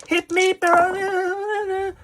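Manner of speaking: cool dude character. Acted. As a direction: stretch it out like a laid-back dude. Player character speaks.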